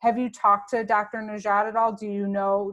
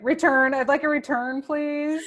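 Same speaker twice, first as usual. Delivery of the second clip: higher, louder joking demand; mock customer request; 5 semitones higher, 6 dB louder than usual. Friend joking about returning a defective body, mock customer request. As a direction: play a customer making a complaint in jest.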